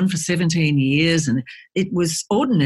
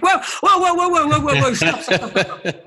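rapid high-pitched protest, tipping into laughter; retold frantic back-pedalling, voice shooting up; mock alarm; 10 semitones higher than usual. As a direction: halt someone in comic panic. Retells halting a woman leaving husband.

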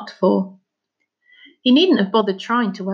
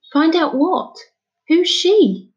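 high, louder, urgent questioning; startled boy; 9 semitones higher, 5 dB louder, slower than usual. Acted. Son hearing of an unknown woman, startled. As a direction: demand answers in a high boy's voice.

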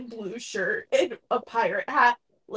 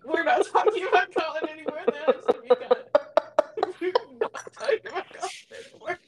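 helpless laughter, pitched high; laughing; 9 semitones higher, 5 dB louder than usual. Real, not acted. Co-host begs to drop the Colin talk, laughing. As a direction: squeal it out through real laughter. Cracking up at co-host's remarks.

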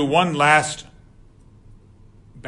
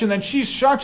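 ordinary delivery

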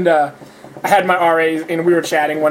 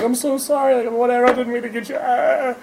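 mock teary apology; 8 semitones higher than usual. Apologizing crying, retold.

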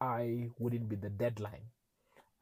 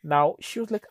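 usual speaking voice

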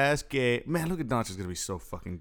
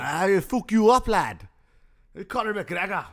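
loud, high mock Irish trash talk; Irish accent; 8 semitones higher, 6 dB louder than usual. Host continues McGregor bit after cohost approves, loud Irish. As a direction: taunt like a cocky Irish fighter. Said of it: "That's better".

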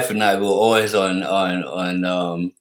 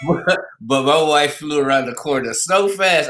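ordinary talk, nothing marked

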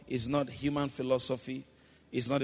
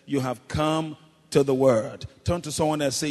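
ordinary delivery

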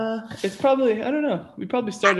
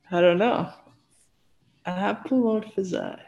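ordinary talk, nothing marked

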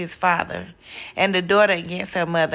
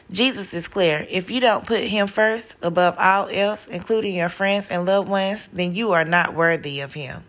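usual speaking voice

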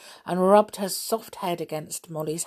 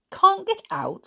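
a much higher, louder disbelieving echo; incredulous question; 5 dB louder than usual. A narrator's reading. Peter echoes the pixie's words, high and incredulous. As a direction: toss it back in disbelief, pitched way up. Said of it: "said Peter".

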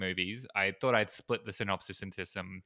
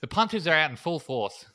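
hammed-up Kiwi vowels; bad New Zealand accent; 5 semitones higher, 4 dB louder than usual. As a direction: badly imitate a New Zealander. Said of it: "the terrible New Zealand thing".

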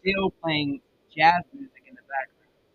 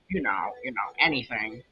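dropped into a lower odd voice; suddenly much lower, strange tone; weird low voice; 4 semitones lower than usual. Real, not acted. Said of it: "why did I sound like that?".

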